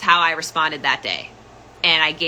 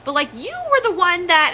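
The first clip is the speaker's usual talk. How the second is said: high mock mother voice; mother's voice; 12 semitones higher than usual. Mother on the phone, high, justifying herself. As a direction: play mom justifying, pitched high. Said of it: "My mother's like".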